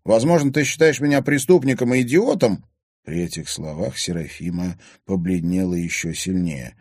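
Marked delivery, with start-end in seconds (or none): 0.1-2.6 loud, high, offended challenge; indignant. A narrator's reading. Son asking what his father thinks of him, indignant. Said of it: "возмущённо произнёс парень".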